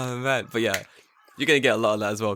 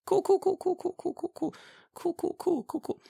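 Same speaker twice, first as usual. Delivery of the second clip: tiny, very high rapid patter; mock nonchalance; 3 dB quieter than usual. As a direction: squeak it out softly, trailing away. Brushing off answer before turning tables.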